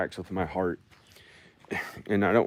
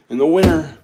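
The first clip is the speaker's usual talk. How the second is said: sudden loud; 8 semitones higher, 10 dB louder than usual. Pretend.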